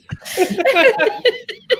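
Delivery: high burst of laughter; laughing fit. Real, not acted. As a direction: crack up helplessly. Cracking up at own story.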